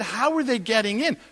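incredulous. Retold or imitated. Landlord asking how monkeys get in, incredulous. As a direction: press for an explanation in disbelief.

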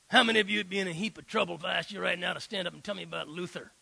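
imitating a gruff pastor, fast, snapping at the crowd; hammed-up tough guy scolding his congregation; blunt tough preacher. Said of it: "That's exactly how he talks".

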